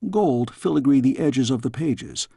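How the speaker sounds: usual speaking voice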